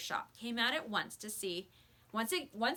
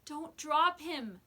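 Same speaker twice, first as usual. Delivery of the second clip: begging; 5 semitones higher, 4 dB louder, much slower than usual. Acted. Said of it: "begged Henry".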